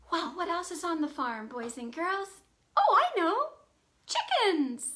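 normal talking voice

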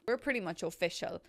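ordinary delivery

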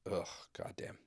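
unvoiced, exasperated whisper; whispered groan. Real, not acted. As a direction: breathe it out in disgust. Exasperated aside.

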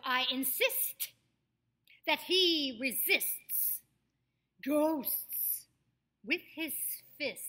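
INSIST, RESISTS, GHOSTS, FISTS slowly and firmly finished; crisp S's and T's. Shown as a demonstration. Coach repeats the exercise, FISTS with crisp S-T-S. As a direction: punch out each phrase, sounding every S and T through FISTS. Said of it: "help us with our S's and our T's".

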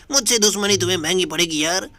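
ordinary talk, nothing marked

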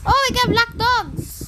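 high, excited disbelief. Real, not acted. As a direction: stretch it out in delight.

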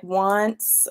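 ordinary talk, nothing marked

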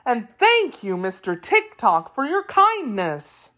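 high, lilting gratitude; eager thanks. A narrator's reading. Wheeler thanking Tik-Tok, eager high voice. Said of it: "replied the Wheeler eagerly".

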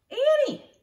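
high, loud yell of her name; boy yelling after his sister; shout. Acted. Jack sees Annie run off.

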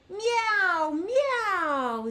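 high playful cat meow. Shown as a demonstration. Host showing kids how a cat says meow. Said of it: "A cat says".

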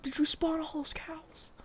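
unvoiced amazed whisper. Real, not acted. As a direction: breathe the question in awe. Sees a field of cows.

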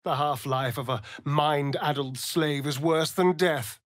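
ordinary talk

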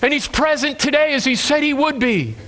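normal talking voice

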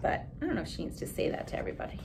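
fast, soft whisper; teacher's quiet aside to class. Real, not acted. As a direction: mutter it confidingly.

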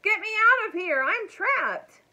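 high, loud cry for rescue; screaming. A narrator's reading. Elmer fakes being trapped to fool the men, screaming. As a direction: yell it out like someone trapped. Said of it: "he screamed in a gruff voice".

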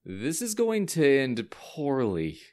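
slow, foreboding drawl; muttering to himself. A narrator's reading. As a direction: draw it out as a private verdict. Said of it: "he said to himself".

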